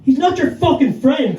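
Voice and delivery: shouted. Retold or imitated. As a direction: snap and yell it at the hecklers. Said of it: "shouted and went".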